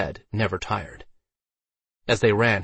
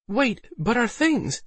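high, slow protesting female voice; protesting Alice; slower than usual. A narrator's reading. Alice to Waldo as belongings are left, protesting. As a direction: draw the question out up high.

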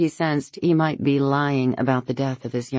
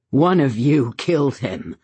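flat hard accusation; accusing; 4 dB louder than usual. A narrator's reading. Grieving father accusing the people in the room. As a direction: pin the blame on them.

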